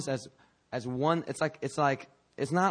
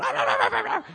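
rapid high-pitched imitated barks; dog barking; 4 dB louder, faster than usual. Sheepdog sent out to round up the sheep, barking. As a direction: yap like a sheepdog. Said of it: "the dog goes".

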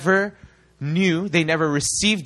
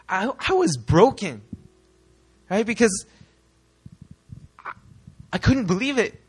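ordinary talk, nothing marked

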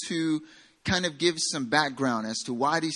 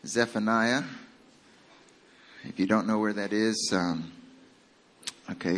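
ordinary talk

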